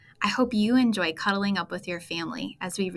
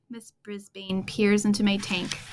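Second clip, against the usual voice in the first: ordinary delivery, nothing marked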